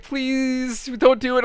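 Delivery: put-on high-pitched pleading little kid voice; begging kid. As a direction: beg like a nervous young boy. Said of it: "he's like".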